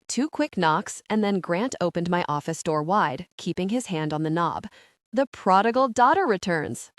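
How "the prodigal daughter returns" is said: mocking. Grant's mocking greeting.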